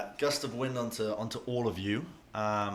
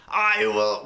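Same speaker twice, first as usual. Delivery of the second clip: defiant shout; 12 semitones higher, 12 dB louder than usual. Acted. GM voices player defying villain.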